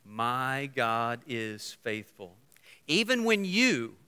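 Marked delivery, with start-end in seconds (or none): 0.0-2.4 slow declaration. Shown as a demonstration. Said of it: "say it with me".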